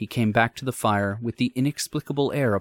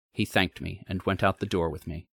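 usual speaking voice

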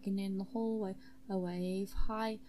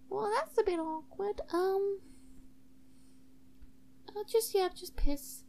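high, halting character voice; awkward student host; 11 semitones higher than usual. Acted. Zara Hall letting the guest use the sink, high awkward voice.

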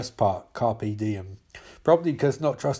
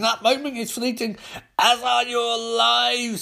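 building warning; 9 semitones higher than usual. Acted. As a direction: pitch climbing to a sustained high. Martian Manhunter's solemn warning, building.

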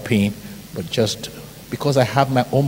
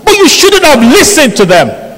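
much higher, louder mock scolding; angry organizer; 9 dB louder than usual. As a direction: shout it like an outraged usher. Organizer scolds him over parking.

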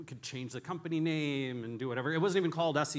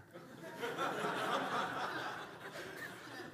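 laugh; 10 semitones higher, 7 dB quieter than usual. Real, not acted. Laugh after punchline.

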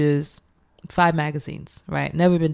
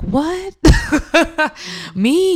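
disbelieving what; 12 semitones higher, 4 dB louder than usual. Retold or imitated. Chefs thank her at Jubilee.

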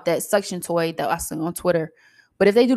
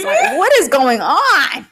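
exasperated yell; 8 semitones higher, 6 dB louder, slower than usual. Retold or imitated. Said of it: "I be like".

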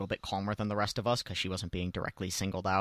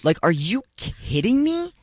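higher, louder, slower disbelief; incredulous outburst; 12 semitones higher, 8 dB louder, slower than usual. Retold or imitated. Retelling the heckler scene, outraged disbelief.

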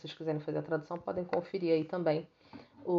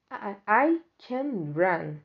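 slow, a touch louder; slow model sentence; 3 semitones higher, 6 dB louder, much slower than usual. Shown as a demonstration. Teacher reading the mouse's line to students, slow. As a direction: read it slowly and firmly. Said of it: "a frase do rato é".

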